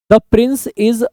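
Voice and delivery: teacher reading out a wrong sentence; slow wrong example. Shown as a demonstration. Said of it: "नहीं लिखेंगे".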